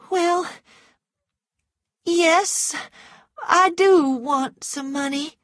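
high, halting woman's voice; reluctant admission. A narrator's reading. Scarlett admits to Rhett she wants money, hesitant.

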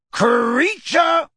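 high, loud angry shout of the name; shouting for the elf. A narrator's reading. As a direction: yell for the elf at full volume. Calling the house elf again.

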